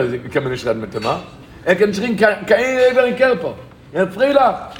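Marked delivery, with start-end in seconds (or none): 1.6-4.7 high, insistent exclamation; emphatic amazement. Retold or imitated. Speaker marvels at man without an Eber, emphatic amazement. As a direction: drive each phrase home in wonder.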